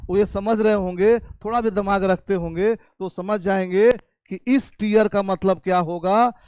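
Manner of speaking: normal talking voice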